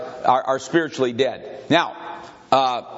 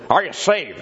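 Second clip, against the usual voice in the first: repeating the preacher's question with more force; Fuller's preaching voice, pushed; 3 semitones higher than usual. Retold or imitated. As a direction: hammer "Are" like a pulpit challenge. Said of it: "That's the way he talked".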